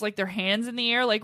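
normal talking voice

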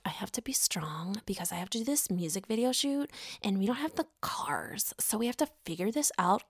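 hushed, whispery pep talk to oneself; mock Candace. Candace calming herself before the shoot, mock whispered pep talk. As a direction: whisper as if steadying yourself, imitated. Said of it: "trying to calm herself down".

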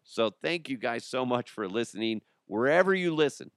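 ordinary talk, nothing marked

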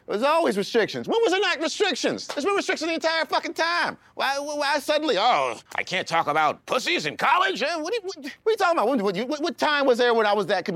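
exasperated rant. Real, not acted. Ranting at complainers about restrictions.

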